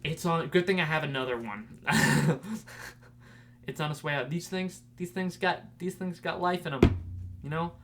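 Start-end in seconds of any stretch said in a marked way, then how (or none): 4.3-7.7 ad pitch. Acted. Ad pitch for bottle.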